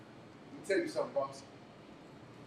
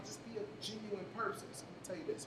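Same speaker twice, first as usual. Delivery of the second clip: usual speaking voice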